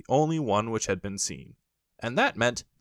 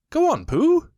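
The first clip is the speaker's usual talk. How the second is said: high, loud urging; high brisk rabbit; eager Rabbit; 8 dB louder than usual. A narrator's reading. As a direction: hurry Pooh along eagerly. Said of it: "said Rabbit".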